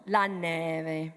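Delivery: la neve with the V almost a B; la neve, lips barely meet on the V; soft V in neve. Shown as a demonstration. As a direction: say neve, V hardly touched. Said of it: "almost be a B sound".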